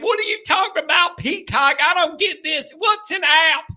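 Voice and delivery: put-on high hillbilly accent rising to a shout. Host mocking a trailer-park fan baffled by streaming, in a hillbilly accent. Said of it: "I could see 'em now".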